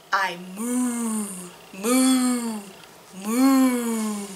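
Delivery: low, quiet mooing cow; a lightly voiced cow; mock cow moo. Cow riddle, cow noises.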